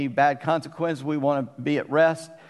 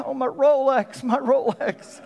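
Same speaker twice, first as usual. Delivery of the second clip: much higher, hammed-up wail; mock wailing over Rolex; 10 semitones higher than usual. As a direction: whine it like a man mourning his watch. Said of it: "And he looked down and said".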